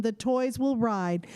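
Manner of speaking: plain reading voice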